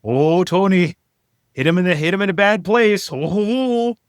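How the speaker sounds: high, loud 1950s announcer voice; old-timey football announcer. Pretend. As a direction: call it like a radio-era broadcaster. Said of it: "do the Statler and Waldorf ... old-timey college football announcer".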